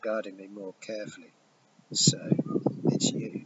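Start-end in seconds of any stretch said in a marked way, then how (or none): 1.9-3.4 lightly voiced villain, half-breathed; a hint of menace, breathy on the last words; hissed. A narrator's reading. Fiery man recognizes the boy, mild hiss. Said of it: "The fiery man hissed".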